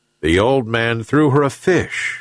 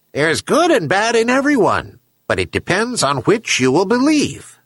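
high-pitched talking seabird voice; herring gull; 10 semitones higher than usual. A narrator's reading. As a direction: answer as a gull, pitched well up. Gull lectures the old man.

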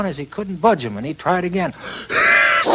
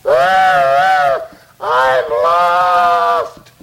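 sad little engine; 6 dB louder than usual. Acted. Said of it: "he looks kinda sad".

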